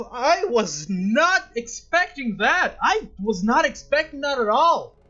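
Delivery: stunned gamer exclaiming about the ending; excited disbelief. Real, not acted. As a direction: really stretch out your shock at the twist. Reacting to a surprise ending.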